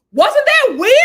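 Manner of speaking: high, loud laughing question; squealing laugh. Real, not acted. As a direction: crack up in disbelief. Laughing about the roast.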